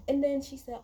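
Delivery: ordinary delivery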